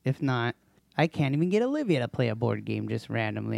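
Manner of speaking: ordinary delivery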